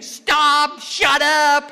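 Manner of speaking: very loud, high scream. Retold or imitated. Woman screaming at the singing man, very loud. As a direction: yell at him to stop. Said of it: "who kept screaming".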